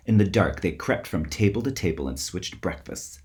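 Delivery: plain reading voice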